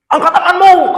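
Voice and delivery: very high, loud police order; commanding shout. Acted. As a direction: bark the arrest order. (polisi membentak pencuri dengan keras)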